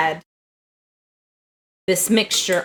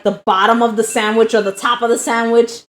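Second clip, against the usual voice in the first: usual speaking voice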